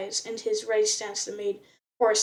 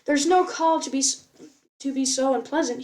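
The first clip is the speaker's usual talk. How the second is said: ordinary delivery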